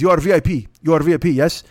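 loosely imitated Russian driver; rough attempt at a Russian accent; mock Russian accent. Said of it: "I don't do accents".

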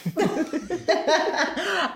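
high, amused burst of laughter; real laughter. Real, not acted. Laughing about her friend threatening to come up, real amusement. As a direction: break into a long, high laugh.

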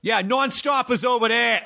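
put-on high, thick Boston-accent driver; exaggerated Boston accent, pitched high; caricatured Boston accent. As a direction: imitate a cartoonishly Bostonian cabbie. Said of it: "the most caricaturized Boston accent I've ever heard".

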